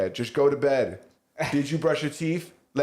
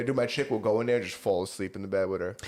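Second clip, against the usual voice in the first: normal talking voice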